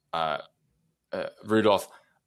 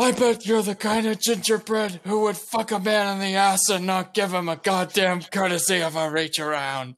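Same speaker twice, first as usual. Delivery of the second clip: high sneering drill sergeant; 7 semitones higher than usual. Acted. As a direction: berate the recruit like a drill instructor. Drill sergeant insults recruit.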